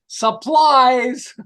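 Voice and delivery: high, loud, stretched-out mock scream; screamed punchline. Man telling his joke to stressed staff, mock scream. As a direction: belt out the punchline in a high yell. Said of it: "opens the door and screams".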